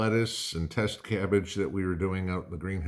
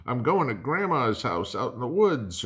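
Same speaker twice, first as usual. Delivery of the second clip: higher, louder, faster panicky cry; mock panic; 7 semitones higher, 5 dB louder than usual. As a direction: play the frantic city dweller fleeing. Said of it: "if everybody goes".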